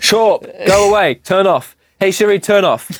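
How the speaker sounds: commanding Siri. Real, not acted. Telling the smart speaker to turn off, bossy. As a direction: order the speaker to shut off.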